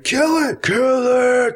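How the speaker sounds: high yelling chant; shouting crowd. Retold or imitated. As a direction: bark it like a bloodthirsty crowd. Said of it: "they were like".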